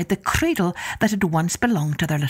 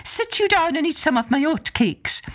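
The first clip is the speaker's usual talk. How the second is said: high, lilting Irish wife; Una's voice; 9 semitones higher than usual. Acted. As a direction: sweetly put off the visitor in a high voice. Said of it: "Una said".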